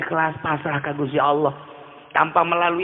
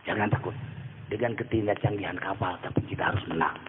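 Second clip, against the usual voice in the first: lightly voiced calm Gus Dur, low and soft; 8 semitones lower, 10 dB quieter, faster than usual. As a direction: lower and softer, a calm old kyai reassuring. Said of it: "Tetapi Gus Dur ngomongnya".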